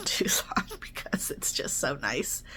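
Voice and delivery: whispered. Real, not acted. Savoring Tucson weather.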